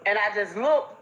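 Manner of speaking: normal talking voice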